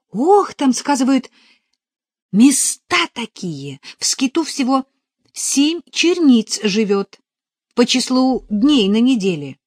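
put-on high, loud, eager girl's voice; chattering novice full of news; excited young novice. A narrator's reading. Надежа tells her news.

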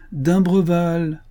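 long teasing hail to the cabin; drawn-out call. A narrator's reading. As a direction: sing the name out long to wake him. Calling to the cabin.

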